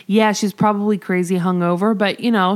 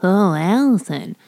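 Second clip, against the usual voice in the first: low, put-on mocking man's voice; Billy; 5 semitones lower than usual. Co-host plays Billy teasing Allison at work, low mocking voice. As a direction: drop the pitch into a hammed-up coworker voice. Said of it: "and he's like".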